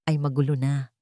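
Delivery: ordinary delivery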